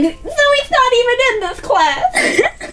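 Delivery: cracking up while protesting; laughing protest. Retold or imitated. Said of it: "And I'm like".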